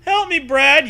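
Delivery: laughing plea. Acted. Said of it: "you laugh".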